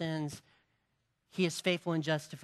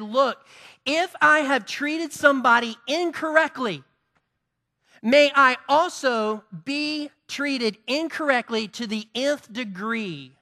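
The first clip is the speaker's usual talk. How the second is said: raised, higher voice daring God to judge; preacher voicing a daring vow; bold challenge; 7 semitones higher, 9 dB louder than usual. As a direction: throw down the challenge loudly.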